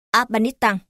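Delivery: Afghanistan said the Southern way, ending in "-tăng"; Southern Vietnamese accent. Shown as a demonstration. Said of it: "Hoặc Nam".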